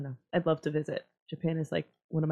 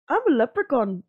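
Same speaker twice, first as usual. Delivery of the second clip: put-on Irish accent; 6 semitones higher, 6 dB louder than usual. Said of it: "There you go".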